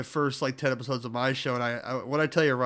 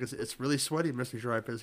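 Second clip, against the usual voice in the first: sheepish quiet; 2 semitones lower, 7 dB quieter than usual. Retold or imitated. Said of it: "I was like".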